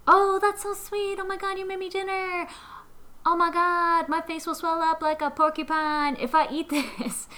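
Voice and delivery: high, gushing, alarmed sweetness; gushing girly voice. Retold or imitated. Allergic roommate gushing over seafood dinner, high and gushing. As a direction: squeal it like a thrilled date turning nervous. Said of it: "And she's like".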